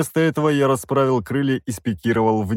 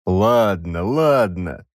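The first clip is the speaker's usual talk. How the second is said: reluctant grumble; much slower than usual. A narrator's reading. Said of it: "неохотно пробурчал король".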